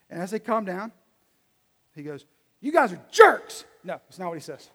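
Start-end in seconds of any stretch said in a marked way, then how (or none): 2.6-3.6 loud, high, drawn-out shout; hammed-up yell at the crowd; mock angry outburst. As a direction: blurt it out as a loud scolding. Joking invented angry outburst at crowd.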